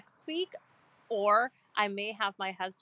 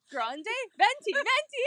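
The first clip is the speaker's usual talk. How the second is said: high excited mock order; mock Tessa ordering; 12 semitones higher, 4 dB louder, slower than usual. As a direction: squeal the sizes like a greedy customer. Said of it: "And Tessa's like".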